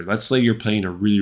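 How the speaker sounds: ordinary talk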